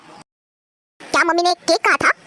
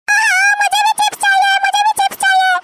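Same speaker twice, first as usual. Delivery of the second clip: put-on demanding little kid; 12 semitones higher than usual. Small cousin nagging the adults for chips, high and whiny. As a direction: repeat it like a kid who wants chips too.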